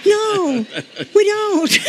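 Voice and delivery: the pastor gently correcting the woman who was cussing; gentle firm correction. Retold or imitated. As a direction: set her straight with a smile. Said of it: "I said".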